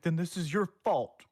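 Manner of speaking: blaming. Retold or imitated. Townsfolk turning on the one who warned, mock blaming. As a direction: throw the fault back at him. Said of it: "It's just like".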